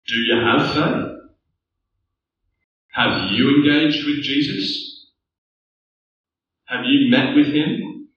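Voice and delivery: ordinary delivery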